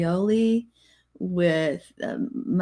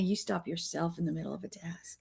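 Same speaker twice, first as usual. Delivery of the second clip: whispered tease; 12 dB quieter than usual. Real, not acted. Teasing reply to husband.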